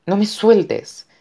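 slow, high-pitched plea; desperate plea. A narrator's reading. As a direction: beg urgently, stretching the words. Mother pleading while being dragged away. (súplica aguda, lenta y urgente)

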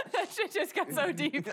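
talking through real laughter; laughing. Real, not acted. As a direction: say it while cracking up. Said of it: "I was so surprised".

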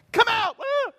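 high, loud exclamation; casting out. Acted. A preacher casting out a demon, high and loud. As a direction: drive the demon out with a whoop.

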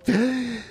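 sudden sharp intake of breath; sharp gasp. Retold or imitated. Podcaster acting out Chimera on the ground, sharp gasp. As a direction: gasp as if coming back to life. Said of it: "takes a breath".

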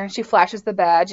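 ordinary talk, nothing marked

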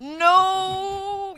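high, long drawn-out no; protesting cry. Real, not acted. Protesting wafer crumbs all over the bed, drawn-out cry. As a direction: wail it out in protest.

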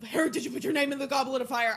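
a hint of a fast, loud Dumbledore demanding; rapid-fire mock-Dumbledore interrogation. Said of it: "fucking full Dumbledore mode".

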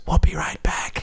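breathy whisper to the camera; whispered aside. Retold or imitated. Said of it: "looks into the camera and goes".

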